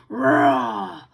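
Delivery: loud snarling growl; loud attacking growls; dog growl. Acted. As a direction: snarl and lunge.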